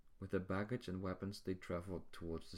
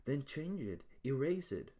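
higher and louder, demanding; angry outburst; 8 semitones higher, 6 dB louder than usual. A narrator's reading. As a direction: snap back at the god in anger. Drake furious at the god.

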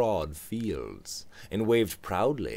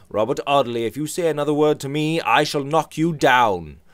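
louder angry warning; George's threat; 7 semitones higher, 8 dB louder than usual. Acted. George threatens to knock Robert down, louder and angry. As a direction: threaten him, voice raised.

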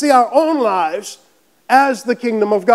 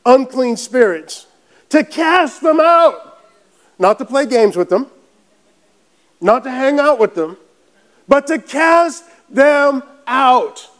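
slow, raised proclamation in weighty chunks; forceful preaching; 4 semitones higher, slower than usual. Real, not acted. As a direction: hammer it home to the congregation.

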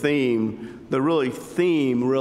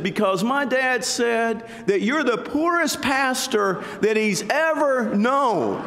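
put-on little boy voice; 8 semitones higher than usual. Boy's punchline to pastor.

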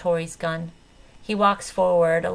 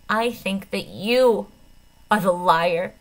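slow, trembling accusation, a touch louder; quaking voice; 4 dB louder, slower than usual. A narrator's reading. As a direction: hold back tears as you accuse him. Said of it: "my voice quaking".

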